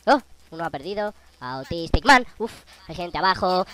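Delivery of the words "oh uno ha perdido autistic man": normal talking voice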